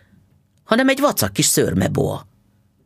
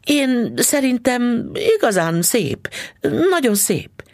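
forced praise; 12 semitones higher, slower than usual. Acted. Wife faking delight.

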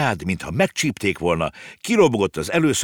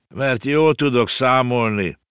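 sour; 5 semitones higher, 3 dB louder, slower than usual. A narrator's reading. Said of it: "tette hozzá Konrád savanyúan".

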